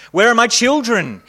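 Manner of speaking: frantic pleading cry; pleaded. Acted. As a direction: beg for the children. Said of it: "he pleaded".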